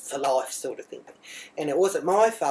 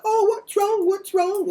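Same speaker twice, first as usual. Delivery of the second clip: put-on much higher, fussing over the crying child; fussing mum; 4 dB louder, slower than usual. Islander mum fussing over her crying son, higher. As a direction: coo at the child in alarm.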